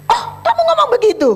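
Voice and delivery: very high, fast, loud surprised reply; preacher reacting in disbelief to her friend. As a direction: squeal it out in disbelief.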